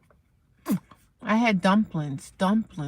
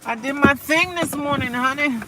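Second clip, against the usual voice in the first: lifted, sing-song sass on "I did my thing this morning, honey"; higher sassy repeat; 9 semitones higher, 5 dB louder than usual. Pretend. To her viewers, repeating her line with sassy lift. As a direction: toss it off higher with attitude.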